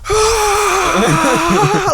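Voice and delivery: loud high mock roar with a laugh; retold roar. Friend retelling a gym roar, laughing yell. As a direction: belt out a laughing mock war cry. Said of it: "just went".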